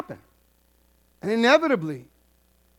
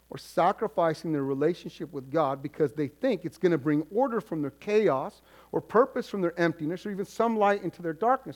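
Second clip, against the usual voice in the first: ordinary delivery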